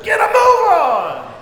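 loud, slow shouted order; shouted command. Retold or imitated. Runner yelling at a teammate to hurry, loud shout. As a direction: bark it down the track at the runner.

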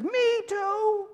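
high squeal of agreement; delighted, way up in pitch; overjoyed to match; thrilled agreement. Acted. Rescuer agrees ever more excitedly.

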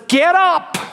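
high-pitched, authoritative command; voicing a miracle command in a sermon. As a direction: raise the pitch and order someone up. Jesus commanding a paralyzed man.

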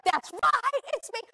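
high, loud That's right, it's me; eager wife. Retold or imitated. Wife claiming the rappelling challenge, high and loud. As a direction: jump at the chance. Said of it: "She's like".

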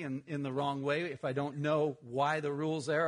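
usual speaking voice

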